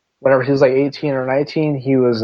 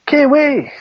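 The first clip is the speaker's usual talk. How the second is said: calling out; 12 semitones higher than usual. Real, not acted. Calling out to someone.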